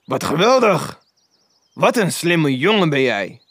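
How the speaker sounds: lively, lifted exclamation; delighted exclamation. A narrator's reading. Scrooge praises the clever boy, delighted exclamation. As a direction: call out with delight. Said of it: "riep Scrooge".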